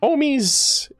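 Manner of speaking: ordinary talk, nothing marked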